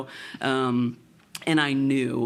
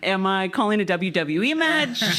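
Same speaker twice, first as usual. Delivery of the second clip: incredulous; 8 semitones higher than usual. Retold or imitated. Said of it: "I'm like".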